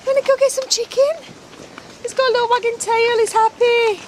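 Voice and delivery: talking to a dog. Real, not acted. Calling a stray dog over for chicken, high sing-song coaxing.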